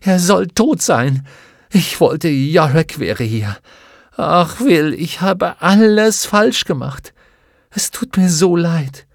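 loud, high, tearful fury turning into remorse; sobbing with rage. A narrator's reading. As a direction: cry it out in angry despair. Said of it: "schluchzte vor Wut".

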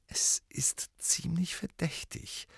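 langsam, tonlos gehaucht. A narrator's reading. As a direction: die Worte nur hauchen. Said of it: "hauchte Hermine". (leise hauchend)

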